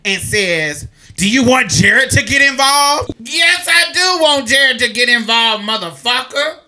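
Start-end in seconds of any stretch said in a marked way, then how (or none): 1.2-3.2 raised, louder challenge; retold threatening question. Acting out the woman's threat. 3.2-6.6 high, loud eager challenge; defiant retold shout. Retells her defiant shouted comeback.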